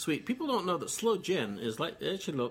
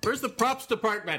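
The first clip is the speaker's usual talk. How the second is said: mock calling out; 7 semitones higher, 4 dB louder than usual. Calling out for props department.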